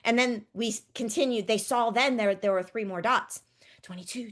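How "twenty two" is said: soft whispered number; whisper counting. Shown as a demonstration. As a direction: whisper it under breath. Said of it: "we whisper".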